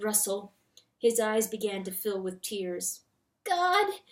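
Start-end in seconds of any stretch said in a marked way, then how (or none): 3.4-4.0 tearful cry. A narrator's reading. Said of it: "was weeping".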